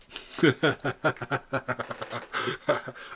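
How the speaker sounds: long helpless real laughter; giggling fit; real laughing fit. Real, not acted. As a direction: crack up and can't stop. Cracks up over mask.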